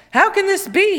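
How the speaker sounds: high, loud delighted amazement; joyful cry. A narrator's reading. The king cries out in joy amid the dancing townsfolk. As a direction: cry out in happy wonder. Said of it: "he cried".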